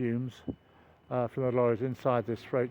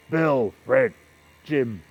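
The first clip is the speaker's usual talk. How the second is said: louder Scottish foreman-style call; Scottish accent impression; 6 dB louder, much slower than usual. Ganger calls the men at tea break, Scottish accent. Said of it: "that's my Scottish accent impression".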